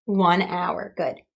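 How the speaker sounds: ordinary delivery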